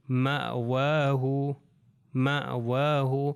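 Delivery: مأواہ slow, short glottal stop after مَ; hamza clearly said. Shown as a demonstration. Teacher modelling مأواہ slowly for students. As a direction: say مأواہ twice with a careful hamza. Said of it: "اس طرح کر کے اس کو تلفظ کیا جائے گا".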